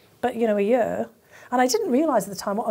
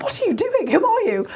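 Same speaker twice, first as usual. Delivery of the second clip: startled man; 12 semitones higher, 4 dB louder than usual. Retold or imitated. Man walks in on mess.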